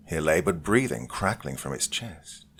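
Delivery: ordinary delivery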